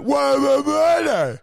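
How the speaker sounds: high, loud mock protest; mock outrage. Mocking people protesting Women's Day, high alarmed whoas. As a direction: wave them down in a shrill fuss. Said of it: "And they're like".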